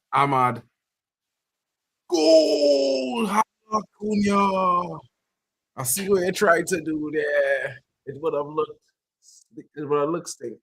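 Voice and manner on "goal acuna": high, drawn-out cry of goal; excited goal call. Real, not acted. Watcher reacting to Cunha's shot, excited. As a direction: stretch the words out high and slow.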